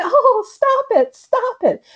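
high, slow laughing protest; laughing plea. Retold or imitated. Wife laughing at her choking husband, high and slow. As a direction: giggle out a nervous stop it. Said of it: "she's laughing at first and she's saying".

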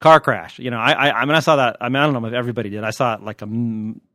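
usual speaking voice

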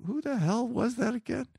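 high, puzzled mutter to himself; confused. Retold or imitated. Viewer lost among characters, puzzled mock grumble. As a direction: ask it lost, like he can't place the face. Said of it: "and I'm like".

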